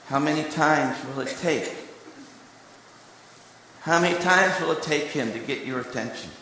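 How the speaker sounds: ordinary talk, nothing marked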